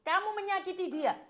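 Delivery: loud, high-pitched rebuke; real, forceful confrontation; indignant accusation. Real, not acted. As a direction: drive the accusation home. Host confronts accused husband.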